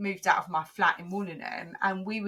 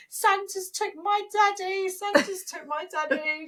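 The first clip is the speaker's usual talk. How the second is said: imitating hysterical child; 10 semitones higher than usual. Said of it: "she was hysterical".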